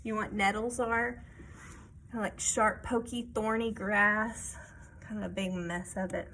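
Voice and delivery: ordinary delivery, nothing marked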